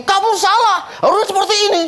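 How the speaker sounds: high, laughing mock scolding. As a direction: ham up a nagging customer. Woman scolds restaurant owner. (omelan tinggi yang ditirukan sambil tertawa)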